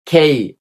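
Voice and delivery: খেই with a breathy aspirated kh; aspirated K demo. Shown as a demonstration. Teacher drilling the letter K with aspirated kh. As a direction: say খেই with a puff of air after the k. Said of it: "হবে খ দিয়ে".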